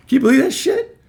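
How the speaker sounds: high, loud disbelief; can you believe it, outraged; incredulous. Real, not acted. Telling audience about the boss, loud and incredulous.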